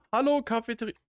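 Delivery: high, loud playful hello; silly loud greeting. Pretend. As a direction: greet the room like a cartoon.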